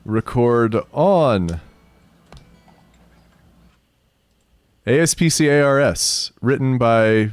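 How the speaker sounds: loud, slow, high announcer intro. Acted. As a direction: present it like a radio host. Announcing the radio play.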